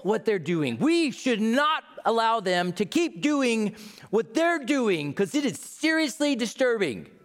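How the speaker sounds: shrill hammed-up complaint; indignant Facebook poster. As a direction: rant like an outraged online neighbor. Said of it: "This sounds like a Facebook post to me".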